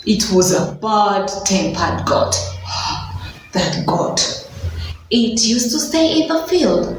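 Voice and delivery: ordinary talk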